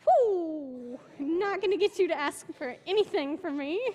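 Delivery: high, loud joking exclamation; retold excited whoop; relieved whoop. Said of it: "And I was like".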